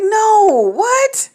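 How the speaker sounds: high, loud anguished cry; sobbing shout of disbelief at the news. Retold or imitated. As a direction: wail it in disbelief. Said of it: "I'm like".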